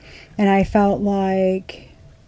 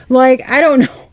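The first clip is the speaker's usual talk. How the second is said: laughing; 5 semitones higher, 6 dB louder than usual. Real, not acted.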